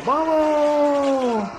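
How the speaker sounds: grito de carga. Acted. Jinetes cabalgan.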